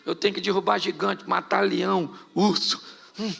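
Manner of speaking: ordinary delivery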